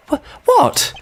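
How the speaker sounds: high startled yelp; shocked shriek. Acted. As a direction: squeak it in shock. Shocked outburst.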